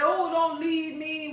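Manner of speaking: mock sob-story pleading; mock pleading whine. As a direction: parody a clingy crying woman. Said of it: "sit around and cry and say".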